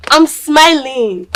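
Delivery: I'm smiling, loud and high, dragged slowly; forced loud smile. Acted. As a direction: shout the smile out through the tears. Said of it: "Calm down".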